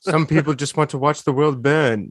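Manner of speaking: lightly voiced British butler quote; a hint of an English accent, slightly low; Michael Caine. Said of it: "the guy that Michael Caine's Alfred talks about".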